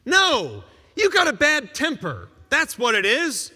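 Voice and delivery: forceful rebuttal. Acted. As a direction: cut through the excuse.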